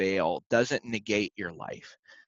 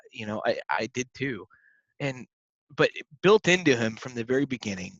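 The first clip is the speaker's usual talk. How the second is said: ordinary delivery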